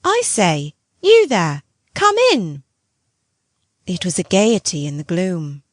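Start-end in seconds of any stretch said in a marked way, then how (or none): 0.0-2.6 put-on light boy's voice rising high; tinkling boy's voice. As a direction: call out brightly like a small boy. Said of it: "His voice tinkled out".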